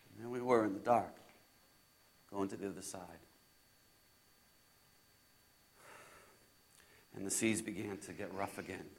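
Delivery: very low, soft, suspenseful hush; hushed storytelling. Acted. Disciple recalling rough seas in the dark, hushed. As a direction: drop right down, low and quiet.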